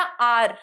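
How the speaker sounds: आर opened on a long "aa"; clear vowel demonstration. Shown as a demonstration. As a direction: draw out the "aa" that starts आर. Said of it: "आ का sound आ रहा है". (आर में "आ" की ध्वनि साफ़ बोलें)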